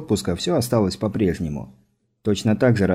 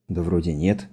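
ordinary delivery, nothing marked

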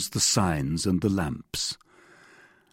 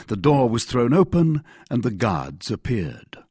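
plain reading voice

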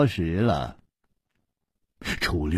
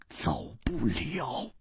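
slow menacing whisper; 13 dB quieter, slower than usual. Acted. Chu Liuxiang warns Nan Gongling, slow whisper. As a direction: breathe it slowly, a threat.

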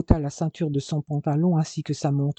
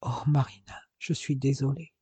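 hushed, breathy apology; barely voiced, an embarrassed lover apologising; breathless cry; 7 dB quieter than usual. A narrator's reading. Jason apologises to Marina in bed, hushed and breathless. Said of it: "Il cria presque aussitôt".

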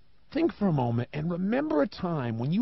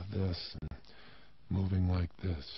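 much lower, softer, with a heavy sigh; low-energy demo; 13 semitones lower, 11 dB quieter than usual. Shown as a demonstration. Showing the audience low energy, sluggish and sighing. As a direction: drag it out, deflated and tired. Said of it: "than if I start talking like".

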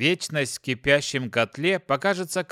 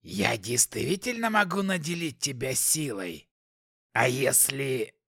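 demonic whisper; 3 dB quieter than usual. Acted. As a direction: purr it like a scheming demon. Demon keeps tempting the hero.

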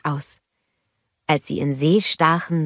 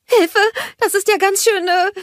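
much higher, louder squeak at hot sand; squeal; 5 dB louder than usual. A narrator's reading. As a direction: yelp as if the sand burns her toes. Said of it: "quietschte sie".

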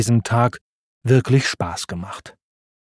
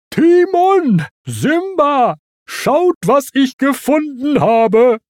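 begeisterter Ruf; 13 semitones higher, 4 dB louder, slower than usual. Acted. Pumba ruft begeistert seine Freunde.

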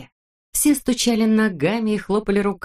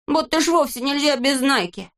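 dismissive Neznaika; 8 semitones higher than usual. A narrator's reading. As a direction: shrug it off with scorn. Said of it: "ответил Незнайка".

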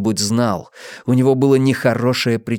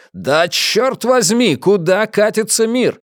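higher, slow, outraged; higher, slow furious man; exasperated rage; 10 semitones higher, 3 dB louder, slower than usual. Acted. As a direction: rail at the world. Travis raging, slow.

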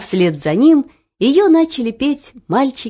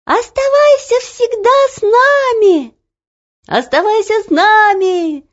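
put-on children's pleading shout; 13 semitones higher, 6 dB louder than usual. A narrator's reading. Children begging the girl to stay, high shouting. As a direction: cry out begging a friend to stay. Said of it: "закричали дети".

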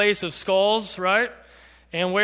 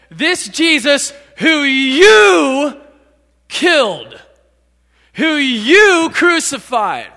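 loud, long drawn-out "you", higher voice; accusing stress on YOU; 5 semitones higher, 9 dB louder, much slower than usual. Acted. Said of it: "Peter just called them murderers".

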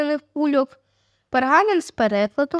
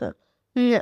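usual speaking voice

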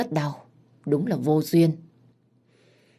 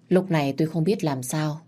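ordinary delivery, nothing marked

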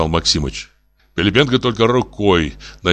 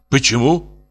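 higher, puzzled question; surprised; 6 semitones higher, slower than usual. A narrator's reading. Professor is refused a loan, surprised. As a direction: ask in mild astonishment. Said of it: "удивился профессор".

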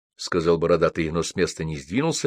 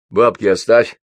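firm, louder order; stern; 3 semitones higher, 7 dB louder than usual. A narrator's reading. Тургенев demands money from departing Байрон, stern. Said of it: "строго произнёс Тургенев".

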